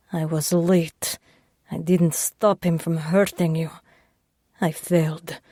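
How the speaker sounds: lightly voiced Russian man; remorseful Russian accent. Sasha guilty confession.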